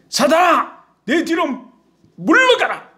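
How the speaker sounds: high, forceful command; stern rebuke. Acted. Jesus roars back at the protesting disciple, sternly. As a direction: thunder an order to back off. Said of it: "호통을 치세요".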